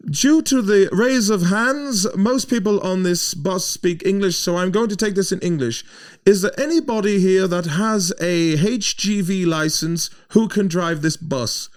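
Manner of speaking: high, loud and slow official announcement; German woman announcing. Retold or imitated. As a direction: rule on the show of hands like a chairwoman. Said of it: "And she goes".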